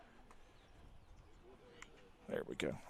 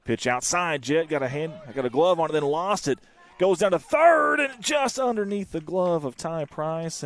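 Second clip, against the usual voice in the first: louder and higher, racing through the stolen base; excited play call; 7 semitones higher, 8 dB louder than usual. Real, not acted. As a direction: call the wild throw as it happens.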